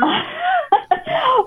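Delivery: high burst of laughter; amused high-pitched laugh. Real, not acted. Laughs at the remake idea.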